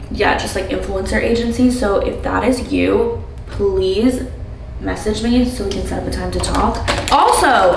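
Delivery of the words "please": ordinary talk, nothing marked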